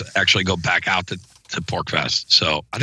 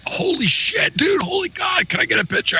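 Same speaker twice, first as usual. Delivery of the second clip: much higher, starstruck yelling; excited fanboy shout. Retold or imitated. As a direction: shout like meeting your hero. Fan realizes who he is.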